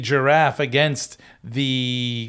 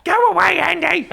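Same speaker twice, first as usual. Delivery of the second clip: much higher, louder mock housewife yelling go away; shrill shooing lady; 5 dB louder than usual. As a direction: shoo him off in a high, hammed-up shriek. Shooing Andy from the door.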